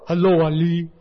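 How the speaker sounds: lightly imitated West African accent greeting; Burkina Faso accent. Neighbor from Burkina Faso answering his door, imitated accent. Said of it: "I love his accent".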